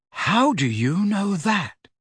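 slow, high-pitched disbelief; astonished. A narrator's reading. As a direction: ask slowly, swooping high in amazement.

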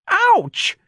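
high, loud yelp; pained cry. A narrator's reading. Woman whose ankle was ground by a heel, pained cry. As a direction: cry out in sudden pain. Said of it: "Missus Riley cried".

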